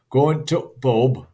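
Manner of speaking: slow, broad Yorkshire demo; Yorkshire accent with the article cut to a stop. Shown as a demonstration. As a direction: draw it out loudly in Yorkshire. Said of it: "like that".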